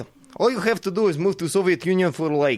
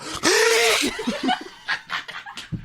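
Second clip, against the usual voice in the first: a phlegmy, much higher snort-laugh; snorting cold laugh; 14 semitones higher than usual. Acted. Showing the host how to make the voice, a phlegmy snort. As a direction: hawk it up like a bad head cold. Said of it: "sound like you have really bad cold".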